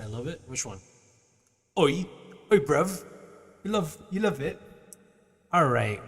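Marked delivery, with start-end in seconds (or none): 1.8-4.6 a loud, higher British bruv; British accent. Pretend. Streamer showing chat a British accent, loud and chirpy.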